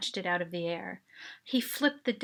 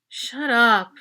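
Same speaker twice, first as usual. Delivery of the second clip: mild annoyed brush-off; 2 semitones higher, 11 dB louder than usual. A narrator's reading. Annoyed teen brushing off friend.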